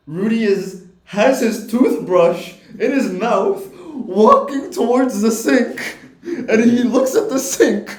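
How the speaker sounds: high and loud, laughing through the words; can barely get it out for laughing; laughing while telling. Real, not acted. Telling friends the dishes story, laughing hard.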